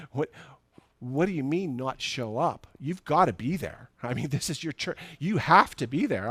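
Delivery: retold alarmed insistence; shocked protest. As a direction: argue back in disbelief that he must come. Retelling his shocked protest.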